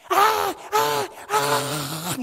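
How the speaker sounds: high, loud imitated sobs; loud mock wailing. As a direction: burst into exaggerated wailing bawls. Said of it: "이러고 우는 거예요".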